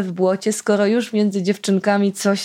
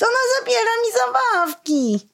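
put-on much higher whiny child's wail; boy wailing; 14 semitones higher than usual. Boy calling his mother for help, whiny wail. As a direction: howl for mummy like a spoiled kid. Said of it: "zawył chłopiec".

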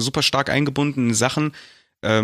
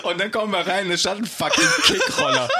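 words squeezed through high laughter; laughing while talking; 9 semitones higher than usual. Real, not acted. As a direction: tell it while cracking up. (lachend erzählt)

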